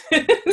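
high, loud laugh; quick burst of laughter. Real, not acted. Host laughs at own remark.

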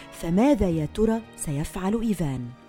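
ordinary delivery

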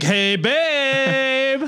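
high, coy mock seduction on hey babe; greeting the wife with the prop as a gift, playful high voice; flirty babe greeting. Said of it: "I'd be like".

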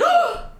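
sharp, high gasp; shocked gasp. Retold or imitated. Reacting to close friend's bold plan, shocked gasp.

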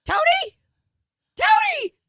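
very high shrieking calls; panicked cartoon squeal. Mister Hanky panicking over sleeping Tony, high shriek. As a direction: shriek his name trying to wake him. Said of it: "he's like".

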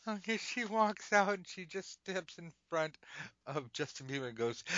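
ordinary talk

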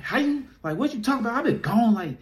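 high, incredulous retelling of his own protest; squeaky high how-could-that-be; high disbelieving quote. Retold or imitated. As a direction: pitch it way up in disbelief. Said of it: "I'm like".

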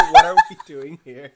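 loud laughing echo; laughing loud repeat. Real, not acted. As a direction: repeat it cracking up. Echoing quote, laughing.

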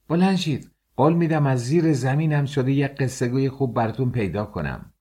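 guard rousing prisoners. A narrator's reading. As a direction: rouse sleepy prisoners with a promise.

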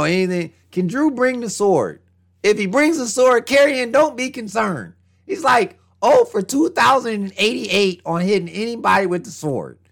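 ordinary talk

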